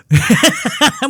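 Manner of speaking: big high burst of laughter. Real, not acted. Laughing at the kid's line, high burst of laughter. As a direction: cracks up high at his own joke.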